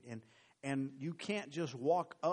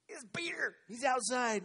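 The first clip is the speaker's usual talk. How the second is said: high, eager news; excited insisting; 11 semitones higher than usual. Acted. Rhoda telling the praying crowd, high and excited. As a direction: blurt it out thrilled.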